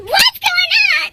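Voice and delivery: mock yell. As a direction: imitate parents calling out in alarm. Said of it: "My parents heard me scream and yelled".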